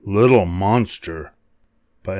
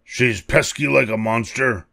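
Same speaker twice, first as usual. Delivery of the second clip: ordinary delivery, nothing marked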